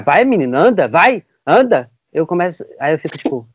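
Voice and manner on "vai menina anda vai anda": impatient scolding. Retold or imitated. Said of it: "ela começa a me xingar".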